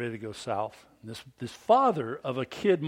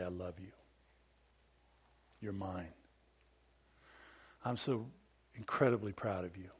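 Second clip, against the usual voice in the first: much softer, low and loving; tender dad; 5 semitones lower, 10 dB quieter than usual. Pretend. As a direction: speak it close, like to your child. Dad telling kids he loves them.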